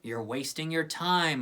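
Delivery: ordinary delivery, nothing marked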